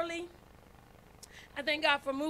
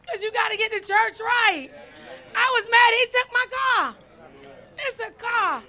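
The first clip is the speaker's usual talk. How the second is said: ordinary talk, nothing marked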